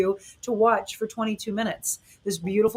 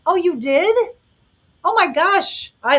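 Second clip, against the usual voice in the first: high, loud burst of real surprise; delighted surprise; 9 semitones higher, 7 dB louder than usual. Real, not acted. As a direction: react with excited astonishment to the news. Reacts to viewer's comment about meeting.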